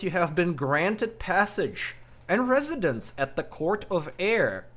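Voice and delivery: stately scene-setting, drawing out the opening; game master's scene setting. Acted.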